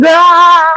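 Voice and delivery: sudden high, loud yelp of alarm; a quick panicked cry; startled scream. Retold or imitated. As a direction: shriek as if water pours on you. Water raining down from upstairs.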